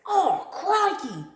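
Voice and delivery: high, slow, amazed "crikey"; amazed exclamation. Shown as a demonstration. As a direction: stretch it out like you can't believe your eyes. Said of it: "something that Australians say when it's an amazing".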